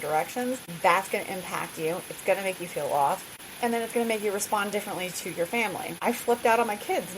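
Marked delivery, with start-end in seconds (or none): none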